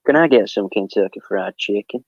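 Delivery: put-on Texan accent; Texas accent. Shown as a demonstration. Performs on request for the streamers, Texas accent. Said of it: "do, do Texas".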